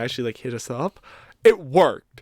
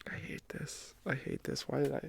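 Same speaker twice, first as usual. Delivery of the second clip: barely audible, whispered to himself; defeated whisper; 19 dB quieter than usual. Real, not acted. Embarrassed guest after confessing on a podcast, defeated whisper.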